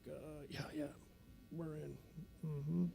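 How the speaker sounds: very soft, hesitant imitated recruits; meek agreement. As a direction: agree under your breath like cowed recruits. Recruits' timid answer to instructor.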